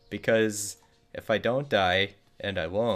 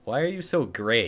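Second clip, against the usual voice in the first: normal talking voice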